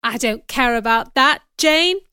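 insistent, impassioned appeal; crying out. A narrator's reading. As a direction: press for an answer emphatically. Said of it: "Mister Rochester cried".

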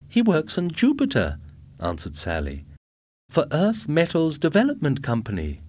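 high, eager wife voice; bragging about her husband; proud young wife. A narrator's reading. Sally answers proudly.

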